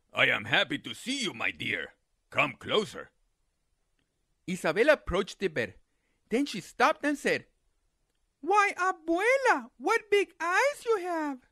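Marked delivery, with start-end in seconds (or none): 0.1-3.1 low, sly coyote voice; a hungry coyote playing innocent; deep sly coyote. Coyote posing as grandma. 8.4-11.4 put-on high, slow wondering girl; high slow curious girl. Girl's voice.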